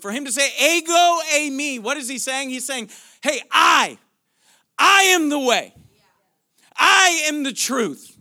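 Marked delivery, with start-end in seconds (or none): 0.6-1.8 EGO EIMI higher and loud; emphatic quote. Retold or imitated. Preacher voicing Jesus's Greek to arrest attention, emphatic. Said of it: "that is how Jesus talks in this moment". 3.2-8.2 loud, high, full-force preaching; emphatic, arresting declaration. Acted. Preacher speaking as Jesus to arrest the congregation, emphatic and loud. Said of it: "that is how Jesus talks in this moment".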